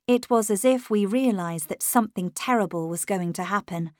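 plain reading voice

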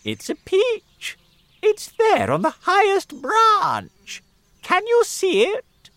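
very high, loud eager voice; thin witchy aunt crying out about the peach; excited cry. Acted. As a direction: point it out breathless with excitement. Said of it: "Aunt Spiker cried".

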